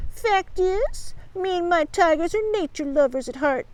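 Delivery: high, soulful put-on innocence; sighing. A narrator's reading. Bugs claims to love nature, sighing piously. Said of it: "he sighed".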